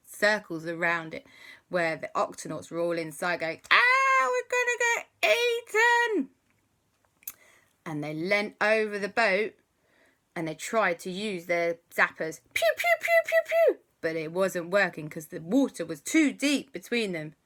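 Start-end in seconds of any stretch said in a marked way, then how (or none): 3.7-6.3 high, loud panicked squeal; high, loud cartoon panic; panicked cry. Octonauts panic inside octopod. 12.5-13.8 high, loud pew pew laser sounds; high, loud cartoon laser zaps; zapper noises.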